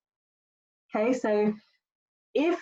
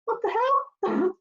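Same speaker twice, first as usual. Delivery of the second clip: much higher, slow, stunned squeak; a kid caught off guard by a pillow; startled kid; 12 semitones higher, slower than usual. Retold or imitated. Kid bopped with a pillow, stunned and squeaking high. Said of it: "they're gonna be like".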